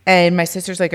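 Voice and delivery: ordinary delivery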